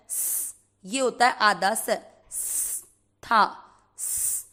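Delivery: स as a bare unvoiced hiss, no vowel; half s hissed. Shown as a demonstration. Teacher shows students how to say half स. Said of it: "आधे स का उच्चारण इस तरह से करना है".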